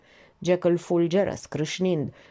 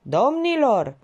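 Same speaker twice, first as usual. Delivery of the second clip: raised voice calling the room to order; tipsy guest calling for attention; throat-clearing toast opener; 7 semitones higher, 4 dB louder, slower than usual. A narrator's reading. A tipsy guest quiets the table, calling out. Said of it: "își drese glasul".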